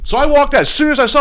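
ordinary delivery

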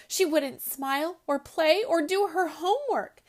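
lively storybook read-aloud voice; story narration. A narrator's reading. Host reading a picture book to children, animated.

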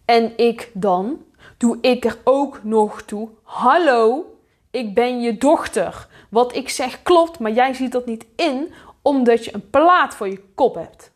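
exasperated pleading that builds in force; indignant outburst. Acted. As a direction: demand to be heard, punching each phrase. Acted indignant outburst at a parent.

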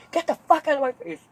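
high-pitched, full-volume furious yell in imitation; mad dad. Dad ordering his kid away, furious yell. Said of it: "He gets so fucking mad at me".

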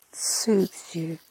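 soft breathy whisper. Acted. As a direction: breathe the words out unvoiced. Whispered soothing in meditation.

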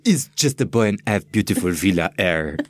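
hammed-up French accent, teasing; mock French accent. Shown as a demonstration. Cohost joins the joke, hammed-up French accent. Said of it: "little French accent".

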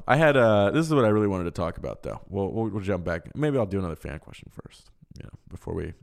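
usual speaking voice

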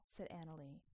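ordinary delivery, nothing marked

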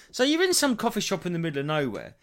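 ordinary talk, nothing marked

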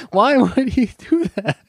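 ordinary delivery

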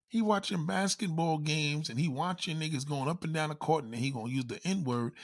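usual speaking voice

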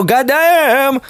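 very high, slow "goddamn" in a put-on voice; catcall callback. Recalling the smile, a high mock catcall. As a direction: echo the street holler, way up high. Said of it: "super excited and the".

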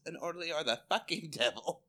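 normal talking voice

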